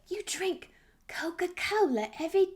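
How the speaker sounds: breathy whisper of a friend's question; whispered quote. Retold or imitated. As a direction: whisper his line in disbelief. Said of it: "well, he didn't sound like that".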